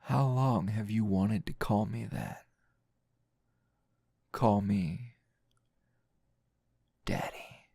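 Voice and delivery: intimate, teasing roleplay voice, close to the mic; seductive tease. Acted. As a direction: lean in and toy with your captive.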